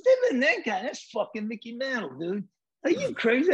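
excited disbelief. Retold or imitated. Telling Boyan the man is Mickey Mantle, high excited disbelief. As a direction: blurt it out, amazed he doesn't know.

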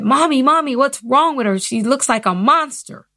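put-on a small kid tugging at mom, only a touch childlike; child's voice. Little child asking her mom about a stranger, lightly voiced. Child's remark retold to mother.

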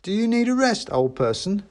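mock-polite child. A narrator's reading. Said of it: "said one of the children".

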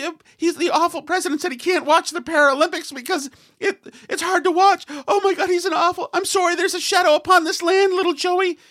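high, whiny mock overwrought voice; mocking hysterical parent. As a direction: ham up a hysterical liberal parent. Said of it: "And I'm like".

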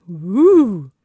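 loud, swooping ghostly "ooh"; playful storytime ghost noise; spooky ooh. Pretend. Host teasing co-host before next story, spooky ooh. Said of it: "I did the noise".

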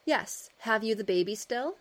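put-on measured reading of an old article; steady reading-aloud voice; story narration. A narrator's reading. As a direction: read it like a period storyteller.

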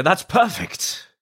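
high, very drawn-out pretend approval; delighted casting person. Imagined casting person spotting her tape, in high, slow delight. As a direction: play someone thrilled at finding the one. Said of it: "someone's gonna go".

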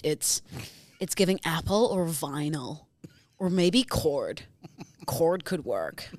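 lightly voiced low vocal-fry influencer. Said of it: "you're an influencer discussing baby names".

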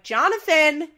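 loud, high mom calling out. Retold or imitated. Mom calling for her son, loud and high. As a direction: call his name across the house, exasperated.

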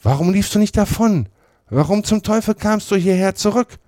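hoch und lauter; vorwurfsvoll. A narrator's reading. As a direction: fahr ihn zur Rede an. Mann stellt Potter zur Rede. (ein Mann stellt Potter laut zur Rede)

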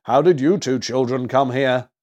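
loud, hearty Santa; jolly old gift-giver, speaking up; merry Santa. A narrator's reading. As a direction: greet the children with merry volume. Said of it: "he laughed merrily".

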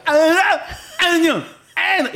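high, loud yell through a numb mouth; frozen-faced shouting. Retold or imitated. Dad stuck mid-pitch with frozen face, shouting for his son. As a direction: shout the name with the front consonant lost. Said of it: "with a frozen face, basically going".

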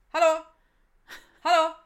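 loud, high rude call across the bar. Retold or imitated. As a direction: shout for the waitress like a rude customer. Customers calling out to waitress.

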